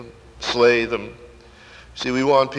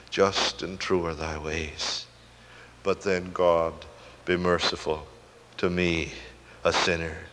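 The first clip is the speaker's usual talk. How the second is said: low, soft, halting plea for mercy; penitent prayer; 7 semitones lower, 8 dB quieter than usual. Acted. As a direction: pray it humbly, pausing between phrases.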